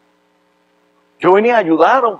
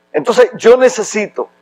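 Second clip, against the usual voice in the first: usual speaking voice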